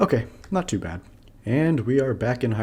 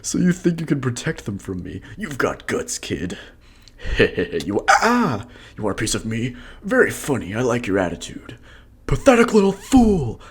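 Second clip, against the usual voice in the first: menacing villain voice; 3 semitones higher, 4 dB louder than usual. Acted. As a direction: bark orders like a villain. Voices Ganondorf dialogue.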